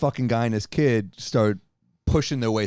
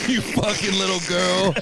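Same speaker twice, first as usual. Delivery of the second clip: slowed taunt in an odd voice; mock dirty talk; 5 semitones higher, slower than usual. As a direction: drawl the insult like dirty talk. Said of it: "dirty talk for you normally".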